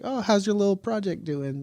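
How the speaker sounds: patronising, belittling question; mock sweetly belittle the project; condescending.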